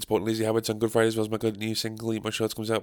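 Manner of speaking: fast, breathless gig plug; hyped-up ad for his headline show; rushed promo read. Acted. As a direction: rattle through the dates quickly. Second promo over music.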